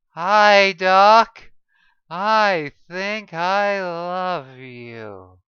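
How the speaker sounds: loud, drawn-out, woozy declaration; sedated patient gushing at the doctor; drugged, loopy greeting. Acted. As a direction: say it blissfully sedated and slow.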